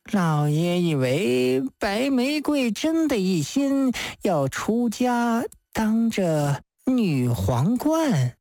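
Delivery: drawn-out tipsy rhetorical question; mysterious drunk. Acted. Drunk man hinting at a secret to the judge, mysterious. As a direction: stretch it out with boozy knowingness. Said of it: "脸色神秘地反问道".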